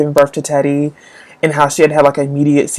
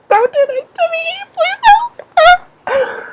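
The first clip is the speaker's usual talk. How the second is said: very high, hammed-up crying plea; mock crying plea; slower than usual. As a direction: beg the author through fake tears. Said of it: "And I'm just like".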